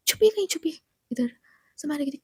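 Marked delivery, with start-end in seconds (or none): 0.1-0.8 panicked. Acted. Panicked urging to hide.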